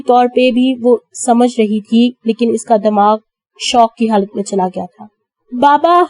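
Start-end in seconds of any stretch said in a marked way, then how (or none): 5.5-6.0 1 crying out. A narrator's reading. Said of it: "وہ یکدم چلائی".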